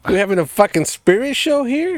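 high-pitched disbelieving jab; loud, mock-outraged question; incredulous. Real, not acted. Mocking lights blinking like exorcism.

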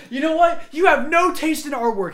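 a hint of a haughty teacher; teacher scolding student over art taste; mock teacher. Teacher voiced in retold story.

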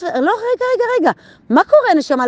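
a high, brisk "רגע, רגע" that stops herself; catching herself aloud. Retold or imitated. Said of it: "אני מדברת עם עצמי בקול רם". (עצירה עצמית בקול גבוה)

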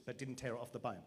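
ordinary talk

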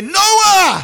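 sudden high, loud shout of Noah; shouted name. Shown as a demonstration. Preacher calls out to a child in danger, shouting. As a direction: yell Noah as a car comes. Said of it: "He's gonna say".

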